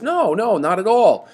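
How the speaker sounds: high, loud retold denial; emphatic defense. Chorus leaping to his defense, imitated emphatic denial. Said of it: "immediately leaps to his defense".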